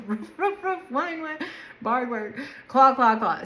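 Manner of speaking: playfully woof and whine like a pup; mock barking. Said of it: "you know what a dog sounds like".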